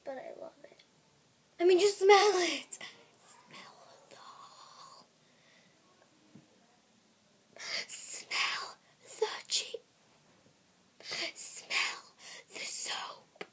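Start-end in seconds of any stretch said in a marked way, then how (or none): 0.0-0.8 whisper. Real, not acted. 3.3-13.4 slow eerie whisper. Pretend. Whispered creepy urging.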